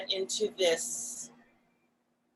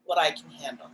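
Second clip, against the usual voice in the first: ordinary talk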